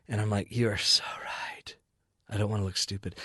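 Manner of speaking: low, hushed, half-whispered realization; quietly conceding the point; hushed agreement. Retold or imitated. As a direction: drop it low and soft, as if to yourself. Acted reply, dropping to a hush.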